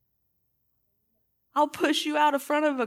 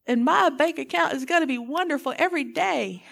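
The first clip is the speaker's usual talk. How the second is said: ordinary talk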